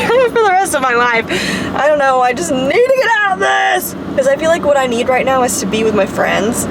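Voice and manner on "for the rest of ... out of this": laughing exasperation. Real, not acted. Laughing despair over her mood.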